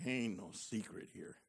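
very low, very soft aside; muttered aside. Retold or imitated. Recalling his own thought, softly muttered. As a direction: mutter it under your breath. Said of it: "I'm going like".

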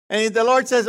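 ordinary talk, nothing marked

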